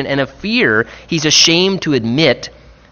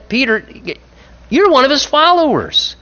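high, pointed accusation; accusing servant girl; 12 semitones higher than usual. Retold or imitated. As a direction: point the finger at Peter.

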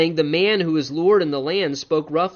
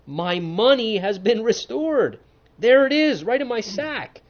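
excited; 6 semitones higher, 3 dB louder than usual. Acted. Brother finds money in sack.

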